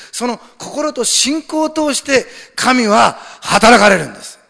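high voice building to a loud climax; fervent preaching. Real, not acted. Pastor urging faith on the congregation, fervent preaching. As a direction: drive each phrase up to full force.

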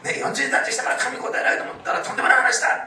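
loud, high-pitched imitation of a formidable scolding; forceful rebuke. Fasting expert rebuking boastful fasters, forceful mock scolding. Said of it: "ああいう人はとなく迫力ありますね".

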